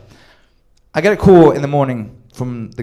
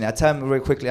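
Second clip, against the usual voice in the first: ordinary delivery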